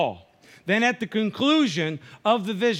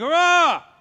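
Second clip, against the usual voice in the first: sudden high loud cry of outrage; high priest's outraged cry; shocked outcry; 10 semitones higher, 8 dB louder than usual. Retold or imitated. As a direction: burst out in a shocked shout. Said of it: "And he's like".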